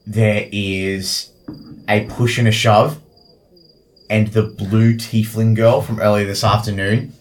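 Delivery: ordinary delivery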